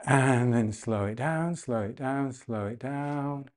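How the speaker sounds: gradually winding down; slowing down. Real, not acted. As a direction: stretch each phrase out to slow them down. Coach calms the breath down.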